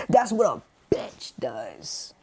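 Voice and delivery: slow, whispered put-down; whispered jab. Real, not acted. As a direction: lean in and whisper the dig slowly. Whispered jab after laughing.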